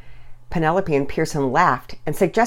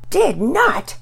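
put-on high childish contradiction; loud denial; 14 semitones higher than usual. A narrator's reading. Brother denying his sister's charge, high and insistent. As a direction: deny it in a squabbling kid's high voice. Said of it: "Pearson loudly denied".

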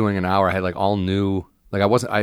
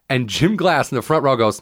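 normal talking voice

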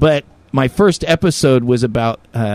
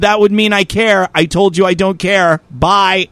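curt dismissal; 6 semitones higher, 3 dB louder than usual. Retold or imitated. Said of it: "I said".

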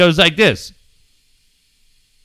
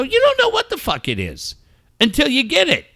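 high, exasperated retort without extra volume; worked-up dad retelling his comeback; retold exasperation; 8 semitones higher than usual. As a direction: argue it back, pitched up. Retold argument with the son.

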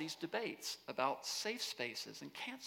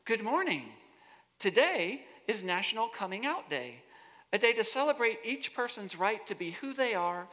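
robot voice; 5 semitones higher, 6 dB louder than usual. Pretend. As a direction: read it like a smart speaker. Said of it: "I'm trying to get the robot voice right".

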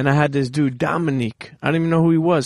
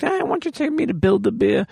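higher-pitched little plea as his daughter; lightly mimicked child's request, pitched up; imitating daughter; 8 semitones higher than usual. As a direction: voice your kid asking for an outing. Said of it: "my daughter's talking to me like".

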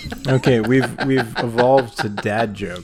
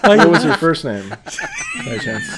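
squeaky high laugh-filled question; asking while cracking up; 10 semitones higher, slower than usual. Real, not acted. Asks through laughter.

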